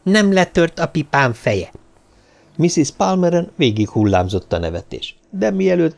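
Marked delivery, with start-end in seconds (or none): 0.0-1.7 higher-pitched exasperated outcry; aggrieved cry over a loss; bitter cry. A narrator's reading. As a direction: exclaim in bitter dismay. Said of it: "kiáltotta Sir Winston elkeseredetten".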